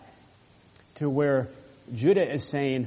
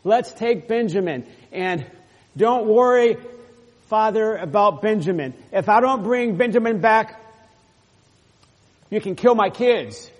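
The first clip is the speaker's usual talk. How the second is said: higher, louder pleading promise to father; voicing Reuben; 6 semitones higher, 4 dB louder than usual. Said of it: "Reuben said what?".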